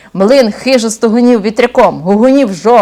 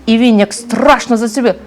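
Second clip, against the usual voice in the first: usual speaking voice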